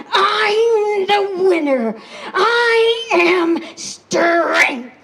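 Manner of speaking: loud, high, slow triumphant scream. Acted. Man screaming his victory over the fallen animals. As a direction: drag out the boast as a victory scream.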